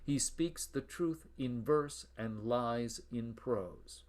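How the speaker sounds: slow, measured verse rhythm; iambic recitation. Retold or imitated. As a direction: lean on each beat like a line of verse. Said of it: "the professor said in a perfectly formed line of iambic pentameter".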